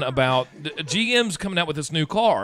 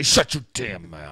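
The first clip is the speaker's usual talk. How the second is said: gruff retort; 3 semitones lower, much slower than usual. Retold or imitated. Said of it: "and I say".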